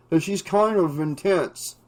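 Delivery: words through a mouthful of burger; talking around a bite of food; mouth full. A narrator's reading. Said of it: "he said with his mouth full".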